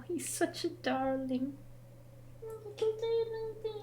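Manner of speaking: high, soft and hushed; tender cooing. Real, not acted. Owner gushes softly about her dog rolling over, tender coo. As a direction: gush quietly over the darling.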